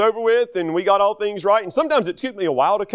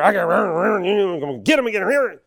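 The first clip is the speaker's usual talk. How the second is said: garbled mock grumbling rant; imitating a teacher muttering threats about kids; negative teacher. Teacher fed up with classroom kids, mock grumbling rant. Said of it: "this negative mindset about kids".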